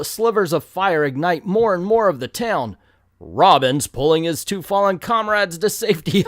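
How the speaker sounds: ordinary talk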